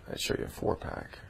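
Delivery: breathy laughing whisper. Real, not acted. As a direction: whisper it through giggles.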